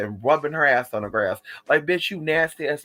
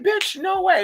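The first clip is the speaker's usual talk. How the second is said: high, loud stunned exclamation; high incredulous cry; shocked disbelief; 14 semitones higher, 3 dB louder, slower than usual. Retold or imitated. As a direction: gasp out your disbelief. Reacting to the fire news.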